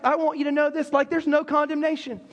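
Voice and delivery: normal talking voice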